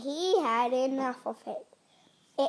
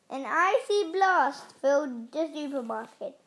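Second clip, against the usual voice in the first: ordinary delivery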